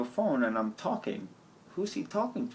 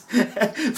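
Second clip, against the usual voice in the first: loud helpless laugh; burst of laughter; 6 semitones higher, 6 dB louder than usual. Real, not acted. Filming himself, he bursts out laughing. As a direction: cracks up at himself.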